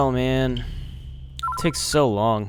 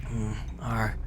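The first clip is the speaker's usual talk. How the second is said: groggy whisper; 3 semitones lower, 9 dB quieter than usual. Acted. Waking up groggy.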